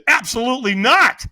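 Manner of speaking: loud, high, firm no; emphatic refusal. Retold or imitated. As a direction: shut the idea down flat out. Refusing the stranger's invitation.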